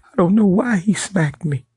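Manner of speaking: high mocking whiny boss voice; mocking the smacked boss. Mocking the boss who called the police, high whiny voice.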